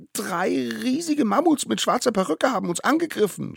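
put-on very high, loud, frantic child; puffing little one. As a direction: squeal the tall tale as if winded. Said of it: "schnaufte der Allerkleinste". (aufgeregt schnaufendes Kind, sehr hoch)

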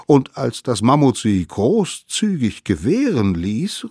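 usual speaking voice

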